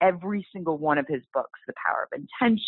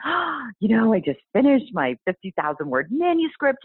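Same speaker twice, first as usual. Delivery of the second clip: excited; 6 semitones higher than usual. Retold or imitated. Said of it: "very excitedly tell him".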